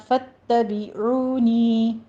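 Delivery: فتبعونی slowly, doubled ta and long oo and ee; teacher's correct model of فتبعونی; correct tajweed reading. Shown as a demonstration. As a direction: recite فتبعونی in full, vowels drawn out. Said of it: "فتبع بھی نہیں کہنا".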